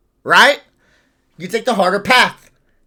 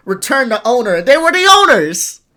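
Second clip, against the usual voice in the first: mock voice; 6 semitones higher, 8 dB louder than usual. Mocking a silly return request.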